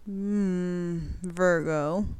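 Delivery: quirky little announcement after a hum; odd delivery. Real, not acted. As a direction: say it oddly, after a drawn-out hum. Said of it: "I don't know why I said it like that".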